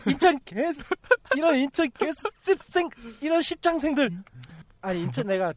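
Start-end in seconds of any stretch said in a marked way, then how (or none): 0.1-4.1 high-pitched furious cursing outburst; angry swearing. Real, not acted. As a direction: spit out the curses in a rage. Cursing at the Incheon client.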